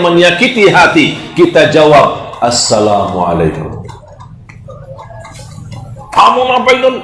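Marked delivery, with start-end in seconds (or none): 2.4-3.8 gentle salam. Acted. Gentle reply to insult. 6.1-7.0 rough insulter. Acted.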